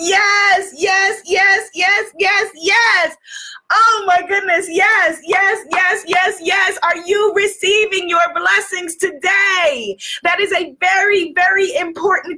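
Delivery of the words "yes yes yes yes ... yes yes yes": high, repeated cries of yes; exultant. Real, not acted. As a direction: celebrate in a raised, ringing voice. Celebrating after the closing affirmation.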